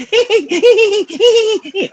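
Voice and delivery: high squeaky giggling laugh; a mock high giggle to show how they laughed; imitated giggle. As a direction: mimic the boys' helpless giggle. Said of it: "it was like a giggle".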